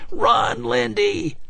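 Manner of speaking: high, frantic call; desperate shout. A narrator's reading. Husband shouting at wife to run, frantic. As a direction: scream her name to save her.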